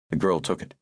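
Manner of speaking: usual speaking voice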